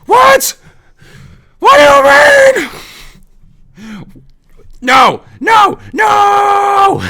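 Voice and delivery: shrieking into the phone, very high and loud; high-pitched furious phone shriek; screaming. Retold or imitated. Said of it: "but she was like".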